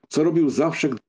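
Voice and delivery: ordinary delivery, nothing marked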